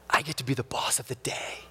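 put-on a soft, hushed little girl's secret; whispered child. Daughter telling daddy her news, whispered. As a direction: whisper it like an excited five-year-old.

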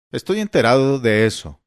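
loud, stiff interruption. Acted. Old father cutting off his son, stiff and loud. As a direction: cut him off, offended and rigid. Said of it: "le interrumpió Tom con rigidez".

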